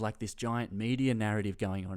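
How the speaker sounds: ordinary talk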